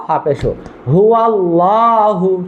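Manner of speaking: slow spelled-out হু আল্লাহু with a heavy la; slow model reading. Shown as a demonstration. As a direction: spell it, then say হু আল্লাহু slowly. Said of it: "কি উচ্চারণ?".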